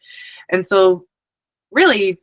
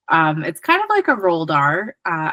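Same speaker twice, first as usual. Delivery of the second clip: ordinary talk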